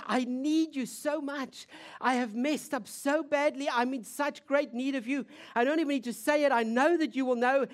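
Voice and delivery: high, urgent pleading; acted outpouring of need; desperate plea. Voices the weeping woman's plea.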